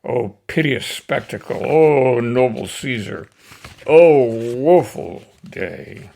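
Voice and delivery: loud lamenting cries from the crowd; crowd hollering. Acted. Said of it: "the crowd starts hollering".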